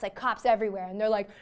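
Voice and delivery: ordinary talk, nothing marked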